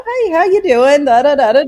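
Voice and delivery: high, chirpy imitation of a caller; breezy phone caller. Voicing the caller's casual greeting.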